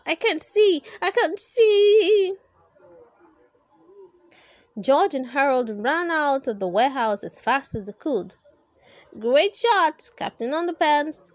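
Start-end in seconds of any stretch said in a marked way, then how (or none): 0.1-2.3 high, loud, slowing blind wail; panicked cries. Acted. Blinded villain panicking with underwear on his head. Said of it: "cried Doctor Dapper". 9.2-11.3 excited cheer. Acted. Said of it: "cried Harold".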